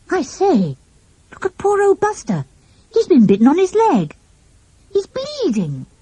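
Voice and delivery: high, distressed voice; alarmed. A narrator's reading. Child sees the dog bitten and bleeding, high and distressed. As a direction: point out the wound in dismay.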